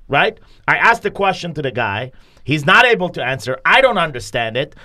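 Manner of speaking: ordinary talk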